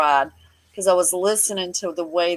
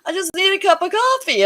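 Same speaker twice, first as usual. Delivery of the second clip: a hint of a whiny old man begging, higher; pleading old man; 8 semitones higher than usual. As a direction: lightly mimic him pleading for coffee. Old man begging nurse for coffee.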